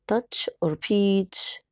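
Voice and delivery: "Touch or peach" read very slowly; slow choice. Shown as a demonstration. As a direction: drag out each option for the pupils. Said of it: "Circle the correct word". (قراءة الاختيار ببطء شديد)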